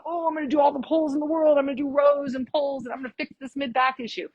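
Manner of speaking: poking fun at a gym-goer's eager plan; mocking gym-goer. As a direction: lightly mimic the gym guy's confidence. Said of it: "I always laugh at the gym when people".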